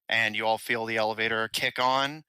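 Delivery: ordinary talk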